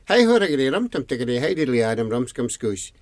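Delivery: very fast tongue-twister of a name; rattled off. Acted. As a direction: reel the long name off at speed. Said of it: "Could you say it slowly?".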